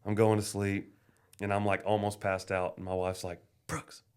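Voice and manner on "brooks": hushed whisper of his name. Retold or imitated. Wife nudging him awake at night, hushed whisper. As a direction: whisper it so nobody outside hears.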